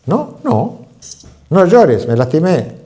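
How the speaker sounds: put-on high, loud stern father telling a little boy off; scolding father. Father scolding his crying little son, loud and stern. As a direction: bark at the crying kid like a macho dad. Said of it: "decía el padre a un chiquito".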